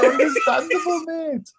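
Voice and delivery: high, hammed-up sympathetic reply laughing through it; mock understanding. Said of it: "We were just like".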